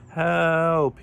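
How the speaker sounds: loud, high, stretched-out cry; screeched. A narrator's reading. Kangaroo banging on the locked door, screeched. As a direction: screech it for help. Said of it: "he screeched".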